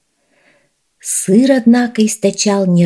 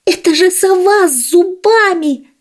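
frightened cry; 12 semitones higher than usual. Acted. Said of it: "закричала полевая мышь".

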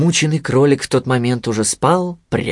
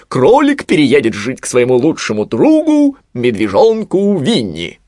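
proclaiming; 2 semitones higher, 4 dB louder than usual. A narrator's reading. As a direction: proclaim it like a verdict. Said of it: "провозгласила сова". (торжественно провозглашая, голосом совы)

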